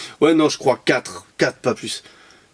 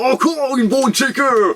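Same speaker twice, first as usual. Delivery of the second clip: high, stretched mock-grand announcement; hammed-up reveal; 8 semitones higher, slower than usual. Vlogger announcing the same model yet again, hammed-up excitement. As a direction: draw it out high, milking the repeat.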